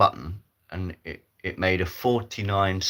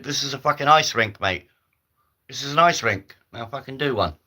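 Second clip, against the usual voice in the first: angry; 6 semitones higher, 5 dB louder than usual. Acted. Rink worker tells him off.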